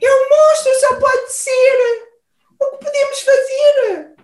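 very high, loud panic; panicked shriek. Acted. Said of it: "Guinchou o alce".

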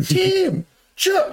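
extremely high-pitched holler; Jake. Retold or imitated. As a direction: imitate calling insistently across a crowd. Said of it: "a hundred and fifty meters away".

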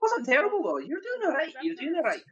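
high, light Irish-lilted encouragement; mock Irish lilt. Encouraging his friend, a high mock Irish lilt. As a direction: praise him in a high lilting brogue.

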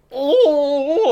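high "oh" as if hiding pain; re-enacted yelp, covering up the hurt; stifled cry of pain. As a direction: let out a high, pained "oh" you meant to hide. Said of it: "like, trying to hide it".

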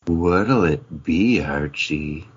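low, very slow, drawn-out comic-book line; milk every word like a melodrama; dramatic reading. Acted. Cohost performs the comic panel as a dramatic reading, low and very slow. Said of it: "a dramatic reading from Archie versus the World".

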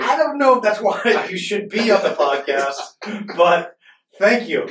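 high, loud and laughing; laughing reply. Retold or imitated. Podcaster answering his friend's odd compliment, laughing and high. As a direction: crack up through a drawn-out, high-pitched reply.

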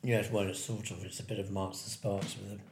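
eating while talking; words around a bite of food; mouth full. Real, not acted. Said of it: "I'm eating with my mouth full".

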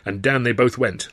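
plain reading voice

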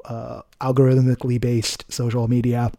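ordinary delivery

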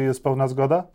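ordinary talk, nothing marked

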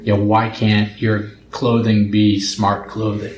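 usual speaking voice

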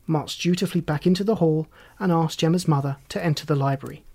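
usual speaking voice

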